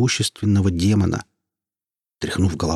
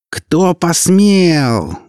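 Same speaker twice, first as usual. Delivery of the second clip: very slow, higher and louder outraged howl; 10 semitones higher, 7 dB louder, much slower than usual. A narrator's reading. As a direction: wail out the outrage. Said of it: "взвыла тут Биат".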